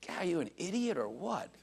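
put-on quoting his young self chiding, lightly; scolding sister. Brother scolds sister who married a jailbird, mild mock scold. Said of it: "I said to her".

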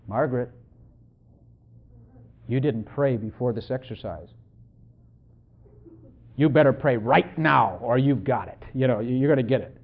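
low, lightly menacing devil threatening Margaret; devil's voice. Retold or imitated. The devil pressuring Margaret to pray, low mock menace. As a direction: drop low and taunt her like the devil. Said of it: "he was going like this".

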